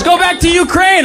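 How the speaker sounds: high-pitched mock shout; retold heckle. Guy heckles the man in the Zelensky outfit, high mock shout. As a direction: call it out high, imitating a street heckler. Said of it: "He goes".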